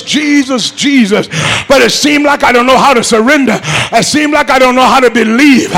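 ordinary talk

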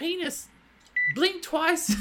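high-pitched New Jersey nurse; comic high New Jersey voice; New Jersey accent. Acted. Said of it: "it's a New Jersey".

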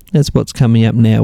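usual speaking voice

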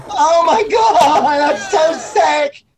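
high, slow, stretched-out amazement; imitating a stoked skater watching the footage; amazed hype. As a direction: gush like you just saw the best trick ever. Quoting amazed reaction to ollie.

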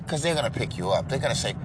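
normal talking voice

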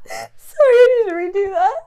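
giggling through the words; can't stop laughing. Real, not acted. Breaks up laughing mid-pitch.

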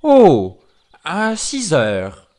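drawn-out wolf reply; wily wolf. Acted. Wolf answering the pig, slow drawn-out voice. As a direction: start high and loud, then linger.

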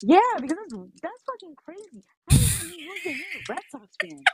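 high pitched mock-disgusted rant; outraged. Real, not acted. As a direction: squeal your disbelief at the traitor. Outraged at Red Sox fan.

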